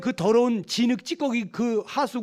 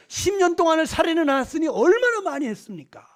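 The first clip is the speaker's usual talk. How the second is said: raised, higher-pitched sermon voice; forceful preaching; 6 semitones higher, 4 dB louder than usual. Real, not acted. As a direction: drive each point home to the congregation. (목소리를 높여 힘주어 설교하듯)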